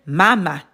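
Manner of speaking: mama stressed at the front, MA-ma; stress on first syllable. Shown as a demonstration. Teacher shows the unaccented word, mama stressed MA-ma. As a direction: punch the first syllable of mama, let the second drop. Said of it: "it's not pronounced mama".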